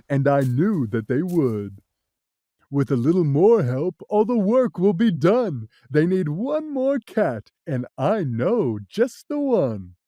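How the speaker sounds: the big cat, confident. Acted.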